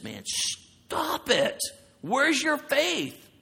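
slow, high mock rebuke; Jesus rebuking. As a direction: stretch it out like a teacher scolding gently. Jesus rebuking a fearful follower.

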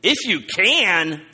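IF YOU CAN thrown back loud, high and drawn out; incredulous emphasis. Shown as a demonstration. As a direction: stretch "If you can" in indignant disbelief. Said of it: "I want you to hear that".

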